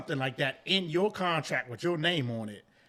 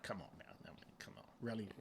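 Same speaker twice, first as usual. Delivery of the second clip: whispered come on now, dropping away; whispered aside; 8 semitones lower, 18 dB quieter, much faster than usual. Real, not acted. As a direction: breathe it out under the breath. Trails into exasperated aside.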